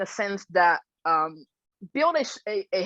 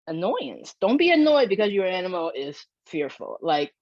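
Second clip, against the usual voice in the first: usual speaking voice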